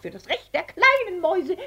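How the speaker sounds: very high squeaky mouse chorus; high, squeaky protest chant; squeaky mice. Acted. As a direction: squeak it out like a crowd of marching mice. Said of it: "piepsten die Mäuse".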